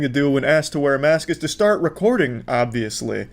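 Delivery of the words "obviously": usual speaking voice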